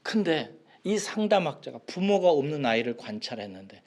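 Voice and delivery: ordinary talk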